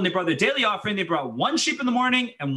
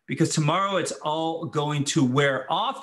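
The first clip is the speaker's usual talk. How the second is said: normal talking voice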